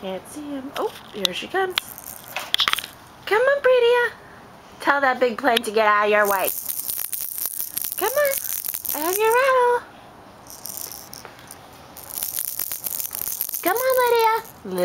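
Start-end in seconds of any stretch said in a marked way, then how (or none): 3.2-13.9 coaxing a wild bird. Real, not acted. Calling baby sing-song.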